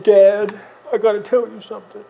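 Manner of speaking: put-on sad little boy. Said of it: "big, sad, teary eyes".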